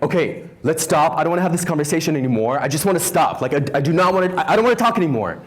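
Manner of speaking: husband in the car, fed up with politics, abrasive and fast. Retold or imitated. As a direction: imitate the husband cutting the argument off abrasively, fast and loud. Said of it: "abrasive voice".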